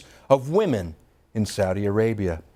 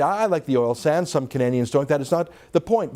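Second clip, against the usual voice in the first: ordinary delivery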